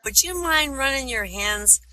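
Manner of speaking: high-pitched put-on rabbit voice. Blind rabbit asking the snake a favor, high rabbit voice. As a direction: lift it high as a little rabbit. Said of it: "the rabbit says to the snake".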